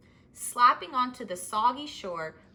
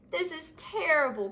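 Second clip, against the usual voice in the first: dismayed cry; 7 semitones higher, 4 dB louder than usual. Acted. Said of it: "cried Verdi".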